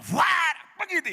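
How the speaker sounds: high, loud mocking yell; mock exorcist shout. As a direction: ham up the exorcist barking fuera. Exorcists shouting at possessed person. (grito burlón de exorcista)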